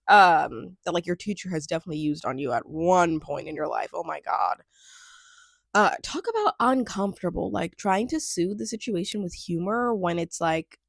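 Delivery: usual speaking voice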